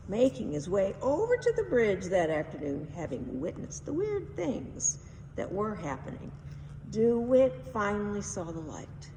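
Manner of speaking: ordinary delivery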